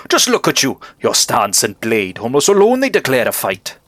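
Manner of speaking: lively, swooping heroic proclamation; valiant bug knight. Acted. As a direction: declaim like a proud storybook knight. Voices the knight's boastful reply.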